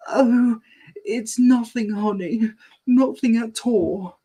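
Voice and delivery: high, gentle reassuring mum; reassuring mom. Acted. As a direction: soothe the child, hide the tears. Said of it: "trying not to make Bingo worry".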